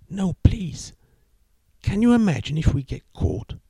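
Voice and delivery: put-on high, anxious young woman; nervous girlfriend. A narrator's reading. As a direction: plead with him, worried. Girlfriend refusing, worried.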